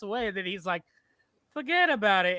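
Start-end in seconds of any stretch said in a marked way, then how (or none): 1.5-2.4 high, loud mock New York accent; New York cliche accent. Imitating the tourist after she walks away, New York accent. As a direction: call it out like a cliche New Yorker.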